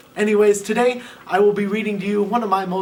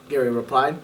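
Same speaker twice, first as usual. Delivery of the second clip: ordinary delivery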